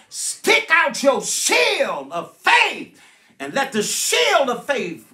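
drawn-out preacher's shout; rousing exhortation. Real, not acted. Preacher urging the congregation against the devil's darts, slow shouted exhortation. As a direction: stretch the key words in a rising cry.

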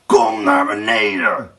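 loud villain's summons; shouted order. A narrator's reading. Blauwbaard summons Erika downstairs, shouting. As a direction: bellow up the stairs. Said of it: "riep Blauwbaard".

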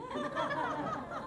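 a high squealing burst of laughter; high-pitched giggle. Real, not acted. Telling a funny beating story.

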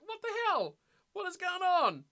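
high-pitched mock disbelief; retold bafflement. Streamer retelling failed reply to Callum, mock bafflement. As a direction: act out being baffled by an error.

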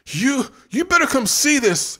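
high, loud stammering panic; sputtering. A narrator's reading. Brent sputtering to Dina in fear. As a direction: stumble over the words in fear.

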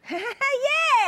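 high delighted laugh; burst of laughter. Real, not acted. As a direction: laugh out loud. Host laughs at joke.

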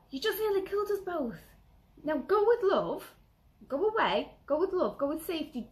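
high, loud, saintly calm to a bad driver; loud serene benediction to the driver who cut in; mock serene blessing. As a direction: bless the reckless driver in a lofty, sing-song voice. Calm driving mantra to reckless driver.

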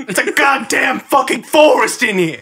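high, loud hammed-up yell; mock shout. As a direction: bellow it like an outraged comic. Bit continues, shouted.

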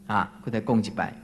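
ordinary delivery

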